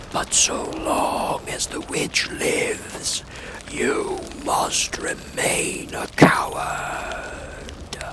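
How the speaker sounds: slow, breathy ghostly whisper; very slow unearthly whispering voice; eerie whispered voice. Acted. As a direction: whisper from inside the fire. Fire voice of Oz.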